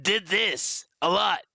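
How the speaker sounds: slow put-on character voice; slow odd character demo. Shown as a demonstration. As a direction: demo the old plush character, slowly. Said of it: "one character I just".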